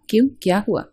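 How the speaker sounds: usual speaking voice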